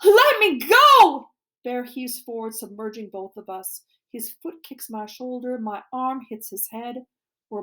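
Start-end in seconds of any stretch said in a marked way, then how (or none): 0.0-1.3 long, high, very loud yell; struggling scream. A narrator's reading. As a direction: drag out a desperate shout to be released.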